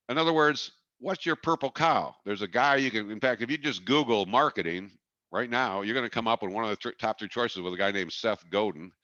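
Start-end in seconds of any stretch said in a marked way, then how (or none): none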